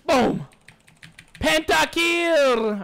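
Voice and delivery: high, drawn-out victory call; triumphant shout. Real, not acted. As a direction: belt out the kill like an announcer. Calls out a multikill in game.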